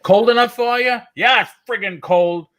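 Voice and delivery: high, brash Boston banter; Boston small talk. Retold or imitated. Two Bostonians grumbling about the weather, high and brash. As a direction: toss it off like a Boston local. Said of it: "You won't get that in Boston".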